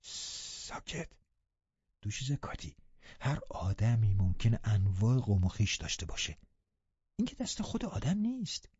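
hushed, confidential soothing; whispered in the ear. A narrator's reading. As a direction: start in a whisper, stay soft. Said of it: "زیر گوشش گفتم".

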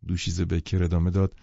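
ordinary delivery

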